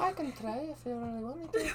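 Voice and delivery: put-on high-pitched Irish woman's accent. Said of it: "could you sound like an Irish woman real quick?".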